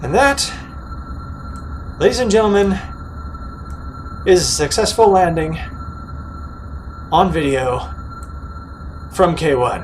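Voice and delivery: loud, proud showman announcement; announcer voice. Acted. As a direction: present it to the crowd. Proud announcement after the landing.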